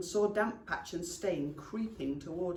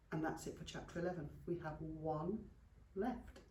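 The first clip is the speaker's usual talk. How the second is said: quieter, relaxed goodbye to listeners; soft sign-off; 7 dB quieter than usual. Real, not acted. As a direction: wind down softly after the story. Soft sign-off after the story.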